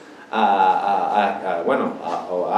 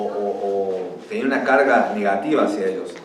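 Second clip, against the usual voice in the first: usual speaking voice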